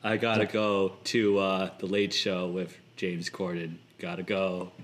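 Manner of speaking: low, soft-spoken comedian impression. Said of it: "my best [name] impression".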